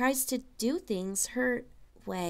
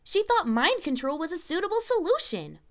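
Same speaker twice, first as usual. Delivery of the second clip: higher, louder snap; snapped; 6 semitones higher, 4 dB louder than usual. A narrator's reading. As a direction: bark it out in frustration. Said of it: "Twilight snapped".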